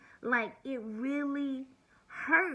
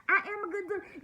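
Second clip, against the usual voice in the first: a hint of Bob's defensive voice, pitched up; mock Bob; 9 semitones higher than usual. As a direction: lightly play the lying man protesting. Voicing Bob's reply in quarrel.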